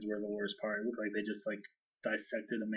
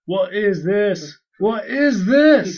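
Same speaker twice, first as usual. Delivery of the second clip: hammed-up, drawn-out shouted impression, building higher; Shane; 9 semitones higher, 15 dB louder, much slower than usual. Introducing an actor by his famous line, shouted impression. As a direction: bellow it in slow mock outrage. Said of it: "Shane from The Walking Dead".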